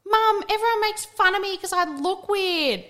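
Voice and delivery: high, childlike mock yell; imitating a kid yelling. Retelling the neighbour's kid, high mock yell to his mum. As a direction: pitch it up like a hurt kid calling out. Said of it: "yell out".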